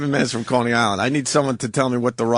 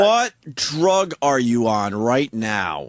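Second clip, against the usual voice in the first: drawn-out, deliberate question; slow, insistent questioning; 3 semitones lower, much slower than usual. Real, not acted. Host pressing the caller on what drug he's on, slow and insistent. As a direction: space out each word for emphasis.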